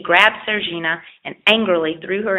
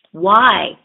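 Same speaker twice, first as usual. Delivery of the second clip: desperate cry; 2 semitones higher, 7 dB louder than usual. Acted. Desperate old man cries out.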